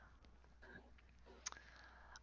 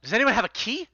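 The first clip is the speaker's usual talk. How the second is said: urgent plea; 11 semitones higher, 4 dB louder, faster than usual. Acted. NPC urgently demands the key.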